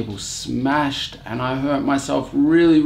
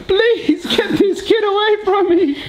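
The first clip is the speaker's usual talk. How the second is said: put-on baby voice; 4 dB louder, slower than usual. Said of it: "Zoe's like".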